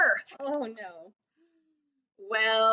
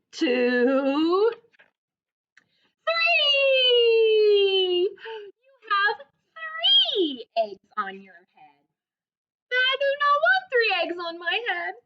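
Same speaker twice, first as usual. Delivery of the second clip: high, loud cry of three; wailing Gerald; 7 semitones higher, 6 dB louder, much slower than usual. Acted. Gerald refuses three eggs on his head, loud and wailing. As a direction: Gerald howling over the eggs.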